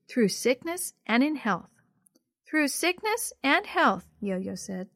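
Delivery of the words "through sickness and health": put-on high, slow little-girl voice; little sister. A narrator's reading. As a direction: repeat the vow slowly as a small child. Little sister repeats the vow.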